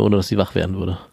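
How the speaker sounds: normal talking voice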